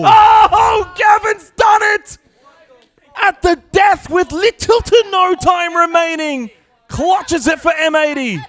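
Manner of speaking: loud, high, drawn-out shout; hype shout. Real, not acted. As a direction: roar the clutch call slowly. Caster calls the clutch win.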